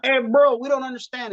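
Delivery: high-pitched complaining Italian guy. Retold or imitated. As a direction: imitate an audience member calling out. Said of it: "So the Italian guy goes".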